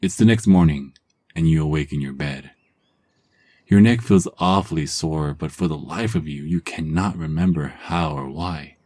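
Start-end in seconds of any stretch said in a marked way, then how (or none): none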